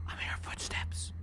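hushed whisper so nothing hears; scared whisper. Real, not acted. Streamer hiding from a killer, whispering. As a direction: breathe it out, barely voiced. Hearing footsteps while hiding.

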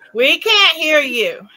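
slow, loud and high "We can't hear you"; calling out. Real, not acted. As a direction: call out slowly, stretching each word. Friend on the stream is still muted.

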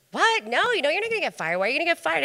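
high, loud and fast, brushing it off; shocked disbelief. Retold or imitated. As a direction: react like it's absurd. Friend's shocked reaction.

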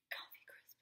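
breathy reverent whisper; hushed candy worship. Real, not acted. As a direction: whisper it like a treasured secret. Savouring candy names, whispered.

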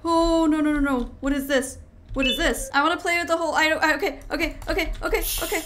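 loud, frantic pleading; terrified, can't get away; real panic, rapid loud no's; panicked. Real, not acted. Chased by a monster, panicking.

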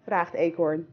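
plain reading voice

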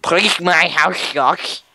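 mock-polite, silly voice; silly put-on voice. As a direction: ask politely in an annoying put-on voice. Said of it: "Don't do that voice".

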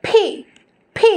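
P said with a strong puff of air, "ফি"; aspirated P. Shown as a demonstration. Teacher models the letter P, aspirated "ফি". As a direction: say "ফি" with breathy burst on the first sound. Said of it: "আমরা বলবো".

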